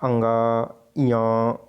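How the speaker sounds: अंगा said with the ng ringing through the nose; nasal sound demo. Shown as a demonstration. Teacher demonstrates the nasal consonant to students, nasal hum. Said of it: "नासिक नाक का प्रयोग हो रहा है".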